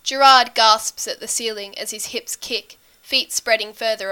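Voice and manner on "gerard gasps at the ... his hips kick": ordinary delivery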